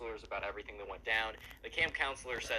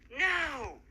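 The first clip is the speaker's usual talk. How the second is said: much higher, loud sharp no; emphatic refusal; 14 semitones higher, 7 dB louder than usual. Retold or imitated. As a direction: shut him down flat out. Emily refuses his date request.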